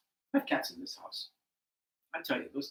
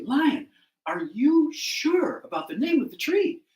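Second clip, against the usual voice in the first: slow, loud, high questioning with big swoops; pointed doubt; 10 semitones higher, 10 dB louder, slower than usual. Acted. Animals question Lion's second guess, slow and pointedly doubtful. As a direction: press the king, drawing out each stressed word.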